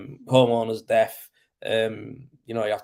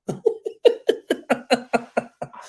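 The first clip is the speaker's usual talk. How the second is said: high, drawn-out real laughter; long laugh; 11 semitones higher than usual. Real, not acted. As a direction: crack up at the machete joke. Laughs at his own joke.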